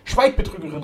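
loud, high outraged command; indignant cry. A narrator's reading. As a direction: silence her with an indignant shout. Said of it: "rief Flora".

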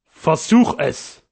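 slow, drawn-out taunting dare; ugly sneer. A narrator's reading. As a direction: draw it out as a nasty challenge. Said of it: "mit einem hässlichen Lachen gesprochen".

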